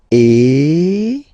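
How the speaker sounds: loud closed e; closed French é. Shown as a demonstration. Teacher reviewing closed é sound. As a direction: lips spread for é. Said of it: "let's see one more time the differences".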